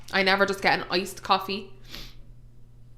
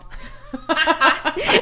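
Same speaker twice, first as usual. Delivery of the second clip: giggle; 6 semitones higher than usual. Real, not acted. Laughing at own joke.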